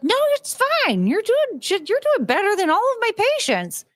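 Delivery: very high, sing-song therapist voice; therapist again. Retold or imitated. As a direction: squeak out the reassurance like a breezy shrink. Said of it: "She's like".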